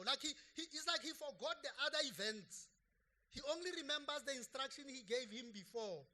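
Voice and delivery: forceful preaching. Real, not acted. Preacher driving home a point to church, forceful preaching. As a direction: preach it out to the congregation with fire.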